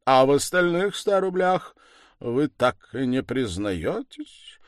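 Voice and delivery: loud opening, then slow deliberate question; reproachful, insistent. A narrator's reading. Accuser demanding a confession from Sonya, reproachful and insistent. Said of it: "укоризненно и настойчиво произнес он".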